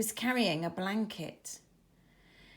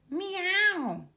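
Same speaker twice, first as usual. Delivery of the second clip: high, thin mewing cat; kitten meow; 10 semitones higher, 4 dB louder than usual. A narrator's reading. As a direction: mew like a tiny kitten. Kitten's cry in story.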